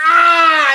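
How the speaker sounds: loud, high, long "aah"; hammed-up yell of pain; mock pain scream. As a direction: imitate a man howling in fake agony. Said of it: "Oh, it hurt so bad".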